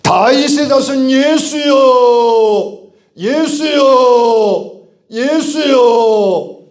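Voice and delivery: very high, long-held cry at full force; desperate pleading shout. Acted. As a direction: shout slowly over a crowd, begging to be heard. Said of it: "간절히 불렀더니".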